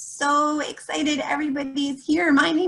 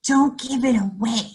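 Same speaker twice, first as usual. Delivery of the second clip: usual speaking voice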